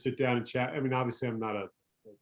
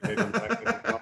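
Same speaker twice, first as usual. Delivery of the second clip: laughing; 10 semitones higher, faster than usual. Real, not acted. Joking about double pay.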